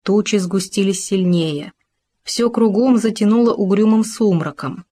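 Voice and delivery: plain reading voice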